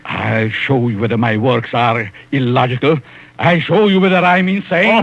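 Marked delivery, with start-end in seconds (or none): 3.4-5.0 rising anger. Acted. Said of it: "Oh, calm down".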